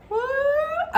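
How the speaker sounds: squeaky shocked "What?" shooting way up high; high incredulous yelp. Retold or imitated. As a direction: blurt it out in disbelief. Reacting to lovers proposal.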